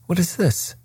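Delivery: sudden high surprised question; a sharp jump of surprise up high. Acted. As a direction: caught off guard, pitch leaping up. Holmes spots something.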